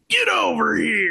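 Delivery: shouted growly ninja battle cry; Scorpion voice. Retold or imitated. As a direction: bellow it like a fighting game ninja. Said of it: "Scorpion would be like".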